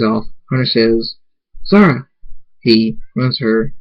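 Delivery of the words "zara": higher-pitched cry of the name; alarmed call. A narrator's reading. Hunter calls Zara after the explosion, alarmed.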